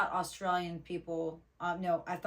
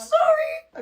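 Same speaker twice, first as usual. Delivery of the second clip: high, loud giggly squeal; laughing apology; 11 dB louder than usual. Real, not acted. Co-host apologizing for derailing the show, laughing squeal.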